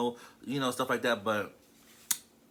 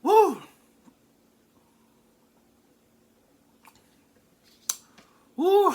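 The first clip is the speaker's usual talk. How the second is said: excited whoop; 8 dB louder than usual. Real, not acted. Tasting the cheesesteak, delighted.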